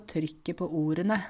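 neat Oslo pronunciation of TRYKKET PÅ ORDENE; Oslo speech. Shown as a demonstration. Teacher showing how they talk in Oslo, Oslo accent. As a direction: say it in standard Oslo Norwegian. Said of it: "sånn som jeg snakker i Oslo".

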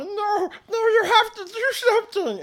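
high-pitched crying voice, loud and desperate; tearful pleading. Acted. Pleading gnome character crying for help.

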